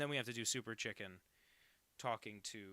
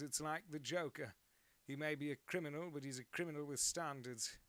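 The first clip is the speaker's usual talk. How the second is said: put-on British accent; 4 semitones higher than usual. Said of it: "Standards. They don't say standards".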